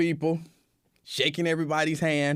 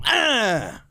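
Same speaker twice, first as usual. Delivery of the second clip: loud high mock cry of pain; pained yell; 10 semitones higher, 6 dB louder than usual. Voicing Lincoln getting slapped, loud high yelp. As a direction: yelp as if struck.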